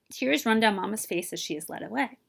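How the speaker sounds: ordinary delivery, nothing marked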